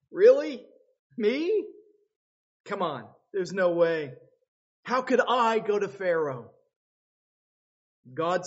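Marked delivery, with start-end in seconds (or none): 0.1-6.5 high, loud disbelieving protest; a startled man balking at a huge task; incredulous Moses. Acted. Moses balking at God's call, loud disbelief. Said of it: "Moses going".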